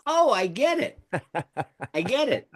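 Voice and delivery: high, laughing eureka; high, giggling wife's realization; delighted realization. Retold or imitated. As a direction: she finally gets it, laughing. Wife quoted understanding at last.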